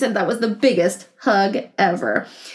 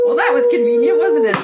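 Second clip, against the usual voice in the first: amused; 9 semitones higher, faster than usual. Real, not acted. Amused aside to child.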